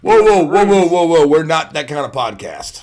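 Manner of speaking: high, loud interrupting protest; loud mock objection. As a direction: cut in hard to halt the story. Host cuts off the story.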